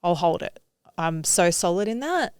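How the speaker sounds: ordinary talk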